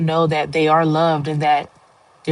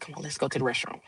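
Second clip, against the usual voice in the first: much softer, lower, hushed; discreet hush; 5 semitones lower, 15 dB quieter, faster than usual. Retold or imitated. Quietly leading a tearful coworker away, hushed. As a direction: keep it down so nobody notices. I didn't wanna draw any attention.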